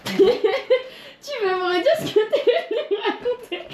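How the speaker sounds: high-pitched and slow through laughter; laughing, high teasing. Shown as a demonstration. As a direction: repeat it giggling, drawn out.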